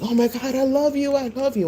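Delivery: high-pitched imitation of adoring young fans; excited kids. Retelling kids at a mini concert, high gushing fan voice. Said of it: "the kids were like".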